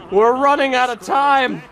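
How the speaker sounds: high, urgent panic; panicked. Real, not acted. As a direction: blurt it out as time slips away. Clock running down in game.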